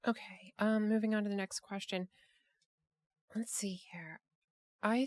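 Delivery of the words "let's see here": soft unvoiced whisper to herself; whispered to self. Real, not acted. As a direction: whisper it while looking something up. Host mutters while searching questions.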